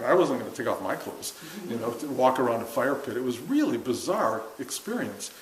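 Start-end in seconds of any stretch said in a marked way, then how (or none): none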